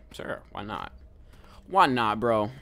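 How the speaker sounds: ordinary delivery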